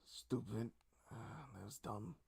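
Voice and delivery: soft, embarrassed grumbling to oneself; embarrassed self-reproach. Acted. Game master voices the embarrassed foe berating himself, softly embarrassed. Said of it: "He looks embarrassed".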